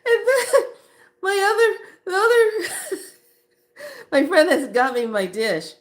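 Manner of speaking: high, loud, breaking into real laughs; talking through laughter. Real, not acted. Storyteller resuming her food story, talking through laughter. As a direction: struggle to go on through the giggles.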